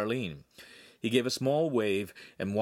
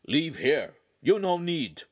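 higher, louder broken-English dog voice; 6 semitones higher, 4 dB louder than usual. Acted. Dogface telling Handsome to leave his trunk, curt.

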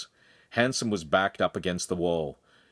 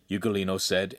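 ordinary delivery, nothing marked

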